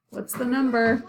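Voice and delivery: normal talking voice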